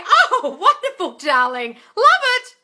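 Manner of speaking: very high, loud laughing delight; delighted squeal. Real, not acted. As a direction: gush over the good news. Delighted at gift.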